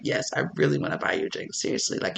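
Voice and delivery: breathless fast whisper; hushed, quick whispered come-on. Retold or imitated. Flirter insists on buying drink.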